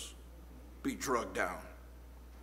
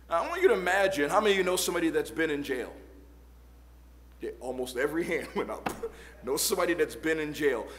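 rushed aside; much faster than usual. Real, not acted.

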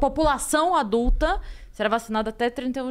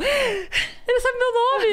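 high, slow, awed squeal; starstruck squeal; 12 semitones higher, much slower than usual. Retold or imitated. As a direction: draw it out, amazed. Mimics starstruck fan.

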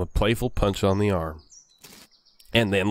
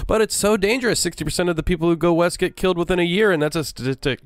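high-pitched, fretful little-brother voice, rattled off; nervous brother; 8 semitones higher, faster than usual. Acted. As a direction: play the worried brother, pitched up and hurried. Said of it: "your brother Rufus is standing here looking nervous".

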